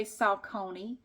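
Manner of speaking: Saucony as sal-CONE-ee; classmate's pronunciation. Shown as a demonstration. Vlogger allowing her workout partner's version, sal-CONE-ee. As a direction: say Saucony with a long stressed "cone". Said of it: "that's how she pronounces it".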